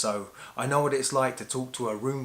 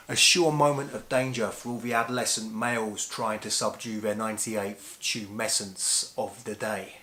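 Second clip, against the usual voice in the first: usual speaking voice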